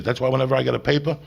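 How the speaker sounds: ordinary delivery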